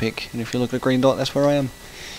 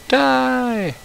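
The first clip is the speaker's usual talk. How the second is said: high hammed-up yell; playful kill cry at a fish; mock battle cry; 13 semitones higher than usual. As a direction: shriek it like a cartoon villain. Killing the fish in game.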